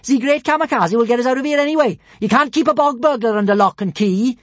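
high, loud boast; boastful shout. Acted. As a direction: shout it with pride. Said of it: "shouted Kamikaze".

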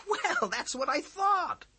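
high, slow bluff covering a mistake. A narrator's reading. Boy bluffs to the teacher after a blunder. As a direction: pretend you knew all along.

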